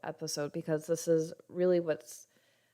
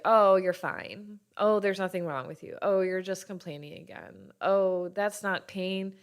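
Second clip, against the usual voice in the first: dismissive mockery; 2 semitones higher, 8 dB louder than usual. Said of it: "people in your life who are like".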